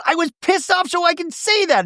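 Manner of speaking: very high-pitched angry protest; hammed-up high indignation; indignant mock retort. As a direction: screech back like an aggrieved housewife. Imitating Shannon arguing back.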